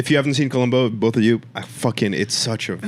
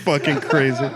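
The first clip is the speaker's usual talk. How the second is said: laughing disbelief; 3 semitones higher than usual. Real, not acted. Comic reacting to blanket gift story, laughing disbelief. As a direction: crack up while saying it.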